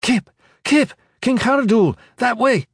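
high, urgent shouted warning; loud high-pitched yell to get attention; screaming. A narrator's reading. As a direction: scream it over the noise of battle. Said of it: "someone was screaming a word".

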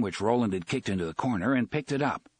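ordinary delivery, nothing marked

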